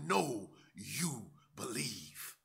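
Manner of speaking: soft, slow unvoiced whisper. Paul to the king face to face, whispering. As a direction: lean in and whisper it. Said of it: "looks the king in his face and says".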